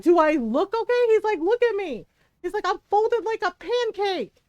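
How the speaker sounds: high, loud indignant complaint; mock indignant. Gamer imitating partner complaining about his flattened body, high indignant. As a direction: complain theatrically about being squashed. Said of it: "And he was like".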